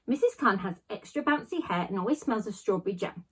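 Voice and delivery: plain reading voice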